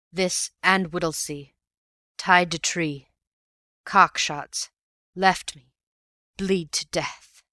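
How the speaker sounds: faint broken gasps, slowing at the end; a dying man struggling for words; lightly voiced weak, wounded man. A narrator's reading. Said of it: "The weak voice failed".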